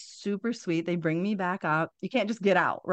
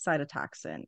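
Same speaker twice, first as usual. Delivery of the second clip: normal talking voice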